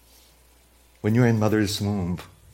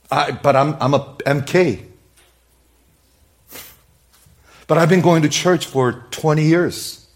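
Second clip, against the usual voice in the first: raised-voice imitation of excuses; mock protest; 5 semitones higher, 6 dB louder than usual. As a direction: object like an entitled churchgoer. Voicing a churchgoer's protesting excuses.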